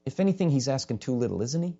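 ordinary delivery

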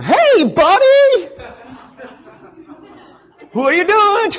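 very high, loud, strained nervous "buddy"; nervous high call. Pretend. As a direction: squeak at the dog in fake friendly panic. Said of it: "kinda going".

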